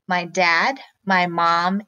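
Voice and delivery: normal talking voice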